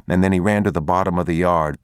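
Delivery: ordinary delivery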